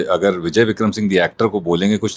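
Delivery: ordinary talk, nothing marked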